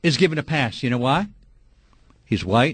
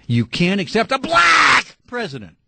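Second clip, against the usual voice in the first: angry shout; 4 semitones higher, slower than usual. Real, not acted. Host telling birthers it's race, angry shouting. Host accuses callers of racism.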